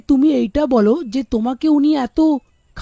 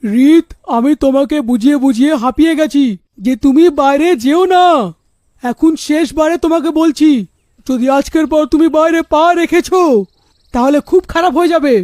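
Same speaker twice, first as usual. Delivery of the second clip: louder, furious father's threat; father shouting; 4 dB louder than usual. Acted. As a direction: shout the final warning at her. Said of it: "ওর বাবার চিৎকারের আওয়াজ আসে".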